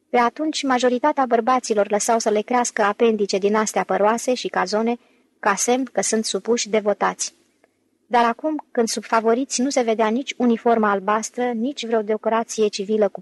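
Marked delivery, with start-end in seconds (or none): none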